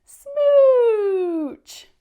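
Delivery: very loud, high, drawn-out smooch; huge smooch. Shown as a demonstration. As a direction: belt out one giant smooch. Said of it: "Can you say that? Ready?".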